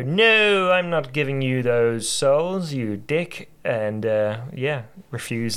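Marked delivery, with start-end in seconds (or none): 0.0-3.5 loud, slow defiant refusal; defiant shout. Retold or imitated. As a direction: shout it back at the devil. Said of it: "Or you can go".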